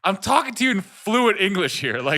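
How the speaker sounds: high, loud exasperated retort; a little angry. Retold or imitated. Arguing with the gas station guy, annoyed. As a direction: retell it with rising irritation. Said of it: "started to get angry a little bit".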